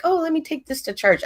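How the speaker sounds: high-pitched imagined thought; mock casual self-quote. Mimics a thought she never had.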